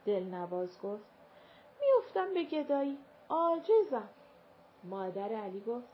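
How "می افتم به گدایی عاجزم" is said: desperate plea. A narrator's reading. Servant begging to stay.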